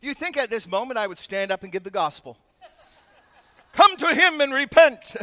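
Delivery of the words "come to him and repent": loud, higher hammed-up altar call; booming mock revival shout; mock preacher. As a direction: pretend to preach the gospel mid-crash. Mock preaching on falling plane.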